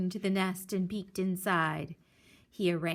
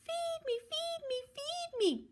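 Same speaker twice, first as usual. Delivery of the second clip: very high soft pleading; hungry baby duck; 5 dB quieter than usual. Acted. Hungry duckling begs Jack, high soft pleading. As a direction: beg in a tiny voice. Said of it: "cried the hungry baby duck".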